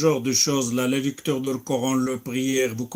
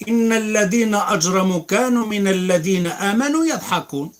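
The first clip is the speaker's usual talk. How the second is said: fast, raised chanted recitation of the verses; louder melodic tajwid recitation; Quran recitation; 6 semitones higher, 4 dB louder, much faster than usual. Acted. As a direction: recite like the imam, quick and melodic.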